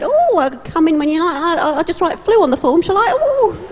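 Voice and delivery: a hint of a panicked office clerk; flustered. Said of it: "flustered person on the other end".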